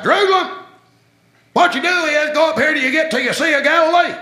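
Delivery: loud, very high put-on country voice; Georgia-style direction giver. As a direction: give directions as a hammed-up Georgia local. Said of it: "people giving directions like we do in Georgia".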